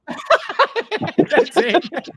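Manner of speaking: words breaking through high laughter; laughing hard. Real, not acted. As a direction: laugh out loud as you agree. Cracking up at co-host's geography joke.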